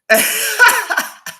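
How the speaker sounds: high, loud burst of laughter; laughing. Real, not acted. Streamer amused after his muted-mic blunder, laughing.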